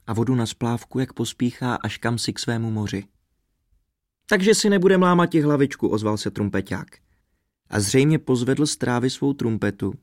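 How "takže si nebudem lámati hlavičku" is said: high, breezy character voice; lightly dismissive, pitched high; carefree trumpeter. A narrator's reading. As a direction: wave the worry away in a raised voice. Trumpeter shrugs off the worry.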